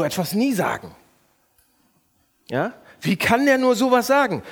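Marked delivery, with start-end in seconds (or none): 3.0-4.4 louder, higher, contemptuous judgment; scornful complaint. Shown as a demonstration. Pastor acting out judging another driver. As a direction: sneer at the bad driver. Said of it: "Merkt ihr das?".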